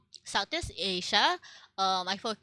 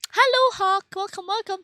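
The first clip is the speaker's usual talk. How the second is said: excited greeting; 10 semitones higher, 7 dB louder than usual. Real, not acted. Greeting a viewer who redeemed.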